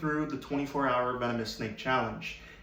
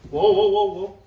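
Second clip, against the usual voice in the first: sudden loud whoa; startled whoa; 3 semitones higher, 8 dB louder than usual. Real, not acted. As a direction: jump back with a loud whoa. Cobra rears up suddenly.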